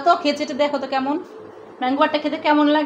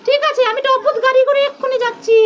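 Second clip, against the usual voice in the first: put-on higher-pitched pouty kid voice; sulky child character; 7 semitones higher than usual. Mother voicing a toy child who sulks off, higher pouty voice. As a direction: play the child toy stomping off in a huff.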